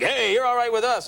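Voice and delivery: a big fireman accepting you into the crew; approving fireman. Retold or imitated. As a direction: firemen welcoming the host, hearty approval. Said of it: "they're always like".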